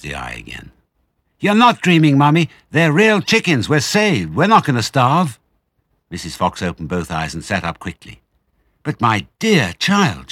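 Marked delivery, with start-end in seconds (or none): 1.4-5.4 high and loud, bursting with joy; excited child. Acted. Small fox telling his starving mother they're saved, high thrilled. 8.8-10.3 astonished cry. Acted. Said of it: "she cried".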